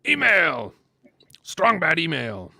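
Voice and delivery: high, loud cartoon shout of Email!; Strong Bad voice. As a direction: bellow it like a cartoon announcer. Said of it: "doing a Jeff McElwee impression".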